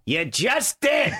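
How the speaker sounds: drawn-out high yell; stretched, high mock shout. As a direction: yell it slowly, mock angry. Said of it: "when he started yelling".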